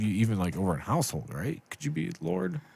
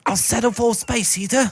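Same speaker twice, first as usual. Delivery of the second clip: higher mock Victorian bargaining; pleading peasant; 12 semitones higher than usual. Worker bargaining with the boss, hammed-up plea. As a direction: haggle humbly with the master.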